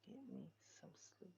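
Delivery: breathy whisper, quick; tossed off under the breath; sleepy whispered aside. Real, not acted. Muttering to self late at night.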